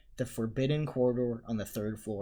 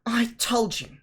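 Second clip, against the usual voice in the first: whispered, out of breath; winded whisper, holding her chest; breathless whisper; 11 semitones higher, 7 dB louder, slower than usual. A narrator's reading. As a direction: wheeze it through a stitch. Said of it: "clutching at the stitch in her chest".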